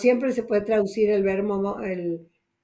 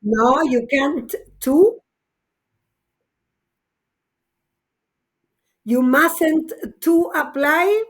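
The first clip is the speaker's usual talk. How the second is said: slow and high, leaning hard on to; slow wrong-form emphasis; 5 semitones higher, 4 dB louder, slower than usual. Shown as a demonstration. Teacher stressing the wrong to in mustn't to apply. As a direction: spell out the wrong to, slowly. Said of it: "Look, look at my face".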